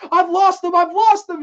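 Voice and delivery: high, loud frantic cry; mock freak-out. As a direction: imitate a man losing it, shouting in panic. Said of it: "he goes crazy".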